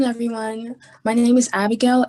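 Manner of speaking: ordinary talk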